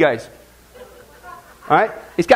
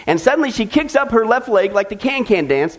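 ordinary delivery

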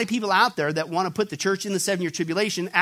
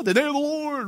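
higher, mocking false-teacher voice on the day of the Lord; 7 semitones higher than usual. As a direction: sneer the day of the Lord in their put-on voice. Mock quote of false teachers.

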